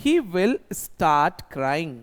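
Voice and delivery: very slow, each word laid out for the class; slow model sentence. Shown as a demonstration. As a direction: dictate it slowly like a teacher. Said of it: "वो मतलब he". (बहुत धीरे, साफ़-साफ़)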